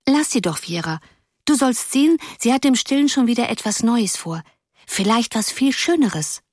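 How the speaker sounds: lightly voiced small girl, high and coaxing; a small girl defending her friend; hint of a sweet little girl; little blonde girl. A narrator's reading. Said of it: "meinte ein kleines, blondes Mädchen".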